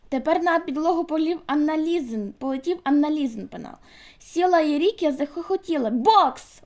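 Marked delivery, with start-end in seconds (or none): 5.9-6.6 loud, playful shout. A narrator's reading. Sol shouts to the class as the girls fight, loudly. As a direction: call it out like a ring announcer. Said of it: "гукнув Сол".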